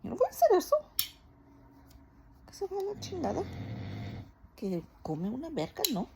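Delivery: loud high angry Spanish outburst; hammed-up angry rant in Spanish; mad Spanish rant. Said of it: "I go Spanish".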